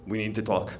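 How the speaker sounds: low, quiet and commanding; a governor pulling you aside, pitched low and soft; a low, quiet order from the governor; Cuomo voice. Retold or imitated. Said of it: "Yes, Governor".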